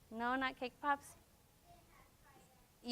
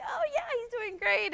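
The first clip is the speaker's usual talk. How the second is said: excited reply. Retold or imitated. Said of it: "And we're like".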